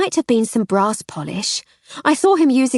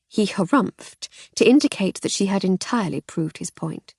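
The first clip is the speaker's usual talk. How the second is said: plain reading voice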